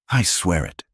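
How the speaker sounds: ordinary delivery, nothing marked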